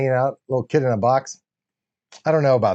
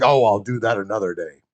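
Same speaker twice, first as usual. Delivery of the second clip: Wilford Brimley; 7 semitones lower than usual. Retold or imitated. Imitating Henry, it comes out as Wilford Brimley. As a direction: slip into a low old-timer voice by accident. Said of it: "that sounded like Wilford Brimley".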